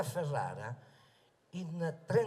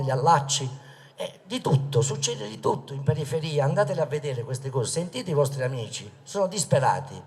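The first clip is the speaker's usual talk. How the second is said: ordinary delivery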